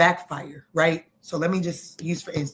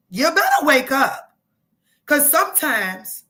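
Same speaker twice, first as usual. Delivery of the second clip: higher and louder, preaching with rising excitement; fired up; 6 semitones higher, 3 dB louder than usual. Real, not acted. Tarot reader hyping up Scorpio viewers, loud excited preaching. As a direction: get carried away, pumping the viewer up. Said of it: "Okay, let me calm down".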